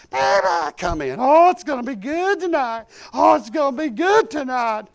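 high, bright-eyed gushing wife voice; excited wife. Retold or imitated. Wife Teresa fired up, calling him into revival, high and gushing. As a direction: gush it, way up high.

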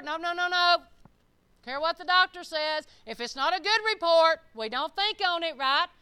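loud, high, forceful preaching. Real, not acted. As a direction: fire it out emphatically, rejecting each thought.

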